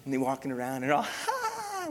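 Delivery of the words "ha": sudden high yelp of a laugh; high burst of laughter; mock kid laugh. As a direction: play the giggling kids.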